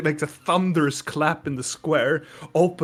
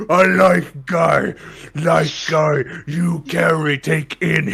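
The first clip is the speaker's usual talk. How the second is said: slow, louder caveman-style bull voice; broken-speech Minotaur; 4 dB louder, slower than usual. Acted. Minotaur praising his new friend, slow broken speech. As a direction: speak like a simple brute.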